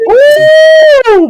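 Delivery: very high, loud whoop. Real, not acted. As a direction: whoop with delight. Whooping at a joke.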